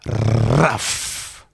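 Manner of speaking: RUFF with a long trilled R; drawn-out, drum-like "Ruff"; rolled-R ruff. Shown as a demonstration. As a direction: roll the R like a drum ruff, "Rrrruff". Drum teacher sounds out the ruff.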